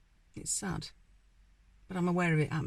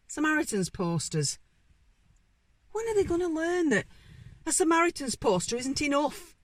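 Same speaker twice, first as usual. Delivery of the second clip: incredulous, rising high and louder; exasperated outburst; 9 semitones higher, 4 dB louder than usual. Acted. Station manager fed up with head office's poster reminders, exasperated. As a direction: burst out in frustration at the futility.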